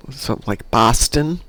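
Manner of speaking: ordinary talk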